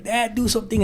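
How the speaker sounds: lightly voiced daughter begging; imitating daughter. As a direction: beg dad quietly as the daughter. Daughter begging dad to act.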